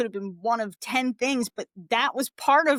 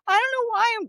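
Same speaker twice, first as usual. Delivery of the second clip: high-pitched, tearful outburst; close to tears; 4 dB louder, faster than usual. Real, not acted. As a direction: voice climbing toward real tears. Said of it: "I'm about to cry right now".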